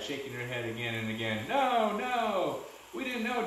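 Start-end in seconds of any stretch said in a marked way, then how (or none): 1.5-2.6 high, pleading cries; crying out. A narrator's reading. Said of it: "Jillian cried". 2.9-3.5 high, urgent protest; exclaiming. A narrator's reading. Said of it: "Jackson exclaimed".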